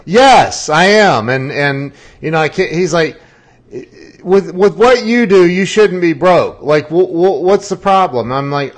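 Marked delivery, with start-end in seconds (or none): 0.1-1.3 laughing admission. Retold or imitated. Admitting he is broke, laughing. 4.2-8.3 higher, louder, pushing tone; imitating a blunt wealthy friend; incredulous friend. Friend challenges him.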